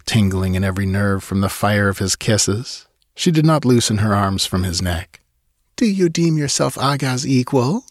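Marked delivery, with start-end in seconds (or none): 5.8-7.9 put-on high, defiant young woman; challenging. Girl challenging Conan in his arms, high and defiant. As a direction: dare him to answer. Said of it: "she challenged".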